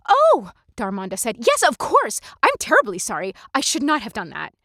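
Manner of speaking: put-on a young woman jumping to apologize; startled apology. Darmonda's high character voice.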